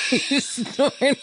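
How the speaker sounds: high laughing shriek. Real, not acted. Laughing at her snort.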